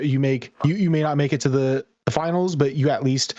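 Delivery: ordinary talk, nothing marked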